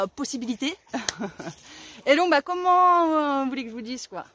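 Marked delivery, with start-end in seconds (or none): none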